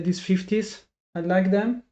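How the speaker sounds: ordinary talk, nothing marked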